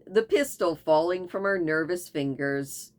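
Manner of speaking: plain reading voice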